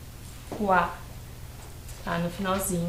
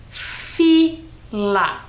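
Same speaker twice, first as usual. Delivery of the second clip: "Fila" split as FI, LA; syllable by syllable; 3 dB louder than usual. Shown as a demonstration. Teacher reads a two-syllable word to pupils, syllable by syllable. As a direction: read "Fila" slowly with a gap between its two syllables. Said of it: "uma palavrinha com duas sílabas".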